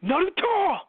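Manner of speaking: piercing high shout of Naruto; high yelled name. Retold or imitated. Sasuke calling Naruto, high piercing shout. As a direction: scream the name Naruto, anime style. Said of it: "It came off as, like".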